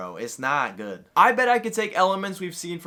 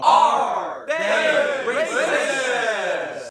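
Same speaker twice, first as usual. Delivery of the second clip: announcer shout; 9 semitones higher, 5 dB louder, much slower than usual. Acted. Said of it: "show title shouted".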